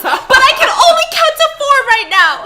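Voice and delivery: high, loud and fast frantic cry; frantic high shout to his sleepy wife; panicked yell. Retold or imitated. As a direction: rattle it off in mock panic. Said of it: "more and more panicked".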